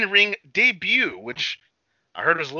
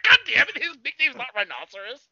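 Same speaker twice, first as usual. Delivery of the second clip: mock outraged outburst; 10 semitones higher, 3 dB louder than usual. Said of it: "And it's like".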